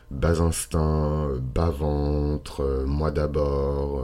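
drawn out, very slow; slow emphatic. Real, not acted. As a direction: spell out each word slowly. Slow emphatic listing of traits. (lent et appuyé)

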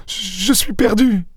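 high, slow moaning wail; despairing moan. A narrator's reading. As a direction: moan it out slowly, high-pitched. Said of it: "gémit-elle".

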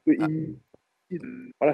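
crackling, breaking-up mic; clipping distorted mic. Real, not acted. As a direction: voice cutting out over a bad line. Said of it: "[name], on t'entend pas très bien".

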